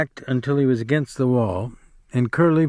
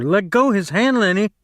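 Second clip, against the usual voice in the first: shouting; 6 semitones higher than usual. Acted. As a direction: shout again and again to break through. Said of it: "George shouted over and over".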